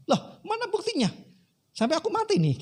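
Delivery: very high-pitched acted protest; indignant disbelief. Dead man disputing with God. (protes tak percaya bernada tinggi)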